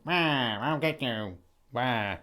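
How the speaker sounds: lightly voiced cartoon villain cackle; a hint of a menacing cartoon baddie; mustache twirling villain. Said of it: "some kind of weird menacingly villainy thing, like twirling his mustache".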